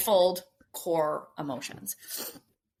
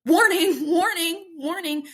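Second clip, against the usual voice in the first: higher, louder warning chant, half laughing; alarm signal voice; 9 semitones higher, 5 dB louder than usual. Retold or imitated. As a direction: sound the alarm like a body's siren. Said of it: "warning signal telling you".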